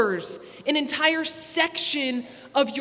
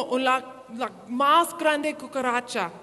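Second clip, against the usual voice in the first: slow, deliberate Spanish; Spanish accent; slower than usual. Retold or imitated. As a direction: spell it out slowly like a host mom. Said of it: "she's like".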